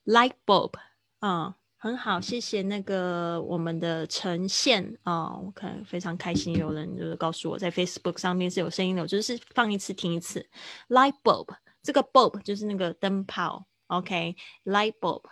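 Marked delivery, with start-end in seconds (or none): 0.1-0.7 Light bulb loud, the t of "Light" held back and swallowed; soft held T. Shown as a demonstration. Teacher modelling the word for students, loud with the t held back. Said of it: "你会发现它那个T很轻". 10.9-11.5 Light bulb loud, the t of "Light" held back and swallowed; soft held T. Shown as a demonstration. Teacher modelling the word for students, loud with the t held back. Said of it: "T很轻".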